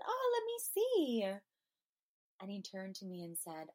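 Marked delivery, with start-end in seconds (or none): 0.1-1.2 bubbly high teasing squeal, much louder; playful. Retold or imitated. Teasing a stranger trying on sunglasses, playful high squeal. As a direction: pop in bright and bouncy, inviting yourself in. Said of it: "being really playful and joyful".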